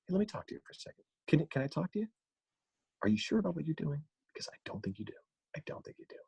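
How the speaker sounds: starts high, sinking to a condescending near-whisper; condescending, fading away to almost nothing; Jason Bateman. Acted. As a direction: begin on a high peak, then talk down quietly. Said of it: "slowly works his way down to a condescending, quiet tone".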